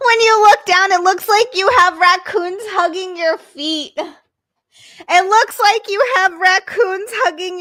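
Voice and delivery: loud high delighted shriek; excited shriek. Real, not acted. Shrieking over the slippers.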